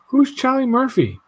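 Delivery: a mother questioning in a high, slow, sing-song voice; curious mom. Retold or imitated. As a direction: play a naive, polite mom asking. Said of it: "she's like".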